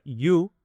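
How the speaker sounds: you said as English "yu"; English pronunciation. Shown as a demonstration. Teacher showing students how to say you. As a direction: model the word you clearly in English. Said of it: "La segunda se pronuncia".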